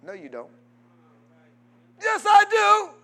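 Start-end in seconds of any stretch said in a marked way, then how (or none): 2.0-2.9 a high put-on voice insisting; mock churchgoer. Pastor voicing a churchgoer who insists he loves God, in a high put-on voice. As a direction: play the stubborn churchgoer answering back. Acted back-and-forth with a churchgoer.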